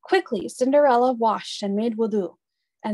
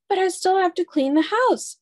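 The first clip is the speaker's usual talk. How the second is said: put-on protesting girl; 9 semitones higher, 4 dB louder than usual. A narrator's reading. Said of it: "said Cinderella".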